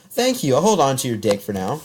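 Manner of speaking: bright high "thank you" bursting with fake glee; enthusiastic. Shown as a demonstration. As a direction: show them how to sound excited. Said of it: "You should be like".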